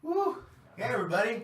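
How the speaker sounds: high, loud whoop and hello; loud greeting. Real, not acted. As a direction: burst out loud once the helmet is off. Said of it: "You're way too loud now".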